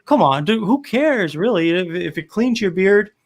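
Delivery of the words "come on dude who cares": ordinary talk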